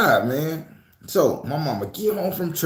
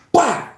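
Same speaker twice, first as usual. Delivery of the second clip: mock hit sound; 11 semitones higher, 4 dB louder than usual. Mama hitting him.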